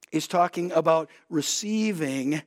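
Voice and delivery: ordinary talk, nothing marked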